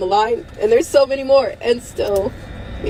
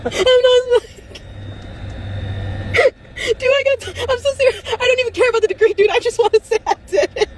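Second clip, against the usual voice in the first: crying plea; 13 semitones higher than usual. Real, not acted. Telling viewers she wants a degree, crying. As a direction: voice far higher, breaking through tears.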